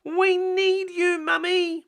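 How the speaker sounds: put-on high, slow little-girl plea; Peppa's voice. Peppa begging Mummy for help, high and slow. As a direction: plead like a small piglet.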